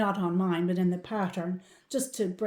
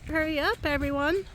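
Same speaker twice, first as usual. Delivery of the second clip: higher, raised call to the herd; 8 semitones higher, 4 dB louder than usual. Real, not acted. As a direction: call the cows in. Calling cows down the hill.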